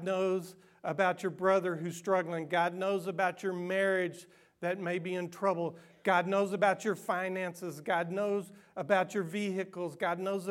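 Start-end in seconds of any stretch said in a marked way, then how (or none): none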